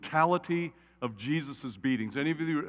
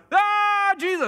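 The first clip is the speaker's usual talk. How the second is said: much higher and louder, mock ecstatic; overjoyed cry; 8 dB louder, slower than usual. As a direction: burst out like joyful disciples. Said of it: "they're just like".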